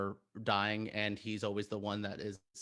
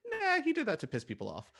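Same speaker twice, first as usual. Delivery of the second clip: dismissive retort; 11 semitones higher than usual. Retold or imitated. Fan answering critics of director's humor, dismissive. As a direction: wave the complaint away, pitched high.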